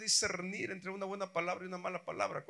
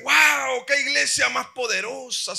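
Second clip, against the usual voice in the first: much louder, higher cry of wonder; amazed shout; 7 semitones higher, 14 dB louder than usual. Retold or imitated. Reader of Corinthians crying out in amazement, loud. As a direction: shout it in awe. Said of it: "usted dice".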